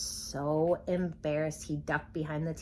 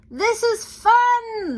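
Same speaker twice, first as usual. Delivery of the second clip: much higher, louder, slow delight; gleeful; 11 dB louder, much slower than usual. A narrator's reading. Animals riding around the barnyard, gleeful cry. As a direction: shout it with glee.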